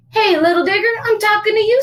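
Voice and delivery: high, loud mother demanding attention; scolding mama. Acted. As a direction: call out a naughty little boy. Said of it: "said Mama".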